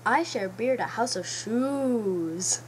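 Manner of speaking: slow, drawn-out little pig; second pig, slow and loud. Acted.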